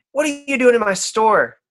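a sharp, high barmaid demanding answers; loud and high, catching intruders in her storeroom. Acted. Barmaid to the intruder, high and demanding.

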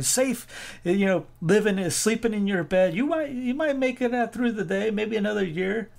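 mock the timid as the movie hero would; Braveheart quote. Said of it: "Where's that Braveheart scene?".

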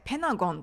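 ペナゴン with the T after N left silent; dropped T. Shown as a demonstration. Teacher showing American reduction, T dropped in ペナゴン. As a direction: run N straight into the vowel: ペナゴン. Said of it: "Tが発音されなくなってペナゴン".

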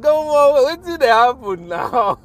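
high, loud, disbelieving cry; loud high puzzled outburst; baffled outcry. Retold or imitated. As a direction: exclaim in mock bewilderment. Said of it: "I mean, I'm like".

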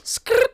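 high, loud tyre-skid squeal; skidding stop skrrt. Pretend. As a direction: screech it like car brakes. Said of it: "don't mean you can say all these noises".

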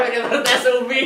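laughing through the words. Real, not acted. Laughing over university name.

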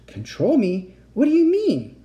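whispery gasp leaping high; gasp, then question in alarm; high-pitched incredulous cry; gasped disbelief. A narrator's reading. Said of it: "I gasped".

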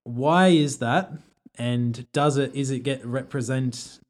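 normal talking voice

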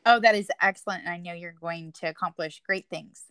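normal talking voice

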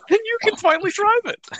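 a high mocking squeak; high squeaky voice.

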